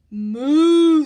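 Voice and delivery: loud mooing cow call. A narrator's reading. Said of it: "said the cow".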